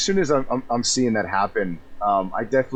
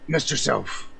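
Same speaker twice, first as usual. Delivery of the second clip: in-character voice; 6 semitones higher than usual. Acted. Player speaks in character.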